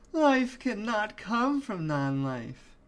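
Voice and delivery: mock screaming. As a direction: protest slowly like an alarmed believer, without full shouting. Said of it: "most theists at this point are screaming".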